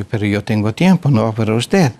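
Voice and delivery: usual speaking voice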